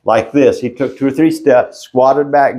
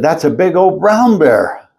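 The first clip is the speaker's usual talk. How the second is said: ordinary talk, nothing marked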